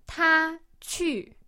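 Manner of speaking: high level 他, then 去 plunging to the bottom; fourth-tone demo. Shown as a demonstration. Teacher demonstrating the tone for learners, high 他 then falling 去. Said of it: "starts out very high and then".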